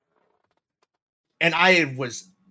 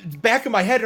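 usual speaking voice

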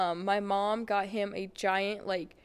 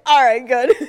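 loud; 15 dB louder than usual. Retold or imitated. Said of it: "And I was like".

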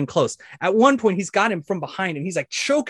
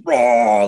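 short, loud, higher roar; crowd cheer; 5 semitones higher, 6 dB louder than usual. Acted. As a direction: imitate everyone cheering at once. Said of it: "and everyone cheers".

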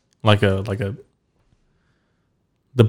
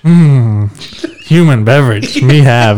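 robot voice; 3 semitones higher than usual. Pretend. Playing caveman robot voice.

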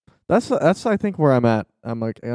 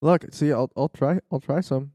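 ordinary delivery

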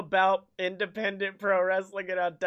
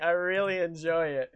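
ordinary talk

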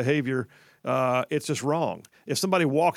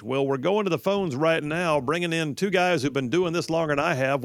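usual speaking voice

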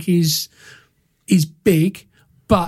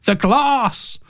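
put-on light German accent, a little higher; 6 semitones higher than usual. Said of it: "but in a German accent".

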